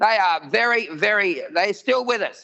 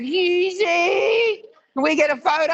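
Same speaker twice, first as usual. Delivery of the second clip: excited fan call; 9 semitones higher, slower than usual. Retold or imitated. Young fans calling out.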